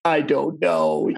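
drawn-out, hammed-up helpless reply; mock tearful. Actor mouthing back to a co-star while being fired, mock tearful. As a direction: stretch it out as if holding back tears. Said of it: "with a tear in my eye".

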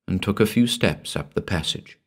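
ordinary delivery, nothing marked

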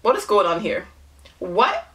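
ordinary talk, nothing marked

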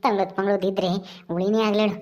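put-on low, unhurried old village grandmother; old granny voice. Old Kadrajji asks the neighbour for curry, low granny voice. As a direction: speak as an old woman angling for leftovers.

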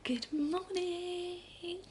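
ordinary delivery

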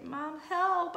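loud, high panicked cry; wail. A narrator's reading. Child calling to mom as balloon floats away, wailing. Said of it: "I wail".

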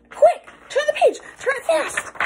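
high, urgent alarm. Acted. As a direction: hurry them on in a panic. Urgent escape from monster.

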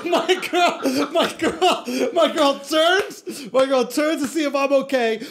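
shouting through laughter; can barely get it out laughing. Real, not acted.

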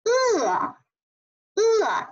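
high-starting 呃 that falls; fourth tone. Shown as a demonstration. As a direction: drop 呃 firmly downward. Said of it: "四声呢向下降了读".

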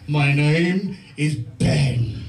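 deep, slow movie-trailer announcer; film trailer voice. Retold or imitated. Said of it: "as if announcing a film".

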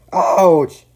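loud, high yelp; shocked ouch. A narrator's reading. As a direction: yelp at a sudden cold shock. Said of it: "he shivered".

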